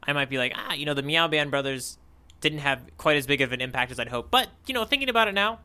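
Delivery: ordinary delivery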